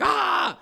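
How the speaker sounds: high-pitched charging yell; hammed-up kid war cry; short high yelp rushing into a fight; mock battle cry.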